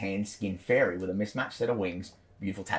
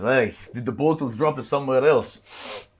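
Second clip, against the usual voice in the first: Goliath voice; 5 semitones higher, 3 dB louder than usual. Acted. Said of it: "he goes".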